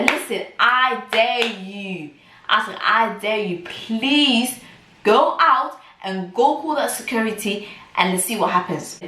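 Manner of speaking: high, drawn-out challenge; retold defiant dare. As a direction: dare them, stretching the words. Retold face-off daring the midwife.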